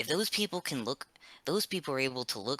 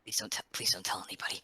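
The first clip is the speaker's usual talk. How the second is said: fast pleading whisper; urgent whisper; 4 semitones lower, faster than usual. Acted. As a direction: whisper it quickly, begging secrecy. Begging friend to keep secret.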